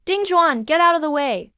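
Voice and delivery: high, urgent warning cry; urgent shout. Acted. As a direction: yell at him to get clear. Warning shout during cave-in.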